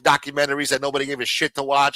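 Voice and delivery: ordinary talk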